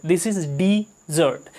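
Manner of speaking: dessert said very slowly as di-ZERT; slow demo. Shown as a demonstration. Teacher repeating dessert slowly for learners. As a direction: spell out dessert slowly with the stress late. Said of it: "डिजर्ट का मतलब होता है".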